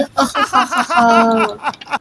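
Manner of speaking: mischievous giggle. Acted. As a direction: giggle after a prank, softly. Chintu pushes Chinki, laughs. (शरारती हँसी)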